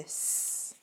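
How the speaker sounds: "S" as a long hissed sss, no vowel; the pure hissing sound of "S"; soft C sound. Shown as a demonstration. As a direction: sustain a soft voiceless sss for "S". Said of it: "le C est doux, donc ça fait".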